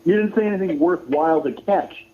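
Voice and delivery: normal talking voice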